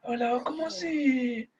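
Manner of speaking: high, slow, drawn-out throaty character voice; the Padrino. Shown as a demonstration. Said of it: "como hablaba el Padrino".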